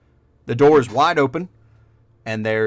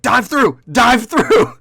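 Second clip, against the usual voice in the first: loud, much higher repeated shout; urgent shout; 11 semitones higher, 8 dB louder, much slower than usual. Acted. Barkeep urges party to dive through, high shout. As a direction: hurry them through the hatch.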